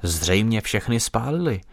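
ordinary delivery, nothing marked

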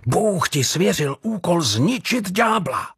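high, fervent insistence; urgent. A narrator's reading. Jordan tells Jean of his God-given task, urgently. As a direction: urge him on like a zealot, voice pitched high. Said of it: "pokračoval naléhavě Jordan".

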